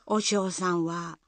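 ordinary delivery

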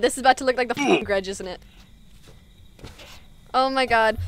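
ordinary talk, nothing marked

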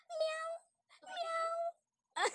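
high playful meowing; sing-song high meow; cat meow. Pretend. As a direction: meow like a little kitten for a child. Teacher imitates cat.

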